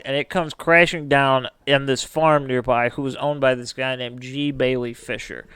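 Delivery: ordinary delivery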